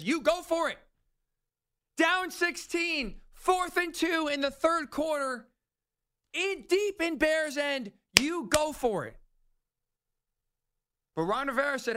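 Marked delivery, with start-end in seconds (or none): 0.0-0.8 raised voice. Shown as a demonstration. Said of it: "common sense says". 2.0-9.2 raised voice. Acted. Ranting about fourth down.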